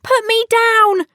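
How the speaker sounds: a high, loud, slow cry from inside; tiny shout. Acted. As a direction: shout from trapped in the pudding. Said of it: "shouted Tom's teeny tiny voice".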